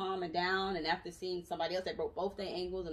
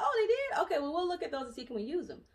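surprised staff; 7 semitones higher, 3 dB louder than usual. Retold or imitated. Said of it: "They're like".

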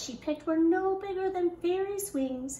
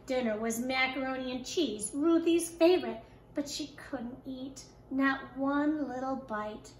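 ordinary delivery

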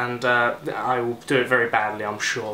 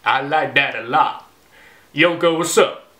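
attempted American accent; 3 dB louder than usual.

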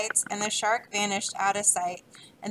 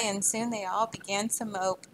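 plain reading voice

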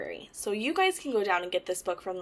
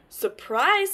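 high, eager "Surprise"; spring it on them gleefully; little duck showing off a surprise; excited surprise; 7 semitones higher, 7 dB louder than usual. Acted.

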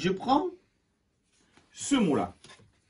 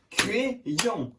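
"Crayon" split into two beats; syllable by syllable; 3 semitones higher, 4 dB quieter than usual. Shown as a demonstration. Teacher confirms the pupil's clapping, syllable by syllable. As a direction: separate Cra and yon clearly in "Crayon". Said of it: "tape dans les mains".

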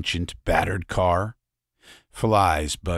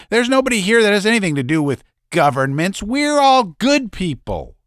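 high, fast, much louder protest; flustered little man; 14 semitones higher, 7 dB louder, faster than usual. Acted. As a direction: squeak out a panicked denial.

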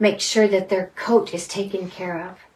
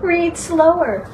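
higher, slow, teasing repeat of read slower; mock Curtis, higher and slow; mock Curtis echo; 7 semitones higher, slower than usual. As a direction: echo Curtis with a playful lift. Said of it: "have you heard Curtis say".

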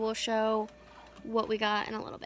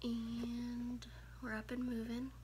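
hushed, groggy morning voice; sleepy morning whisper; 16 dB quieter than usual. Real, not acted. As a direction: whisper it like you just woke up. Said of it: "I still got morning voice".

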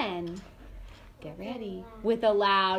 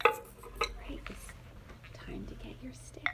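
whispering; 2 semitones higher, 11 dB quieter, slower than usual. Real, not acted. Teacher whispers aside to class.

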